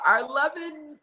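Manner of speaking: ordinary talk, nothing marked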